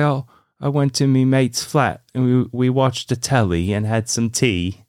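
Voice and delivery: lilting mock British, starting high; chatty imitation of a British accent. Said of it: "a much better British accent".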